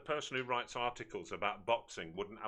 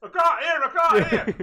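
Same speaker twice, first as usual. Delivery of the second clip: much higher, loud far-off shouting; through a wall; 17 dB louder than usual. Pretend. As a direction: yell as if from the next room. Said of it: "Like we're talking through the walls of a pub".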